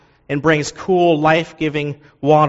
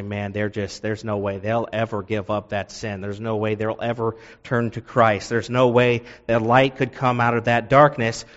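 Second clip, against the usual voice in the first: much softer, resigned skeptic who has given up on them; doubting aside; 6 semitones lower, 6 dB quieter than usual. Retold or imitated. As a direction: say it low and softly, as if nothing will change. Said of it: "We say".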